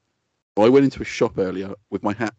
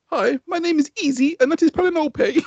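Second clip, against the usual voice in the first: much higher put-on woman's voice; mock mum. Friend imagining a mum introducing herself at a party, high and sweet.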